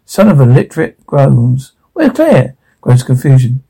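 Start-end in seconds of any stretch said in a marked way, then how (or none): 1.9-2.5 much higher, frantic cry; a father searching for his missing daughter, frantic; panicked question. Acted. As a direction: call out in sudden panic.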